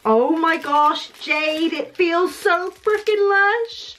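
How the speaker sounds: slow, rising gush of delight. Real, not acted. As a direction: savour it, drawn out and thrilled. Delighted at feel of notebook.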